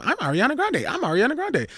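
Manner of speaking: high, sing-song mock of a diva. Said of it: "I was like".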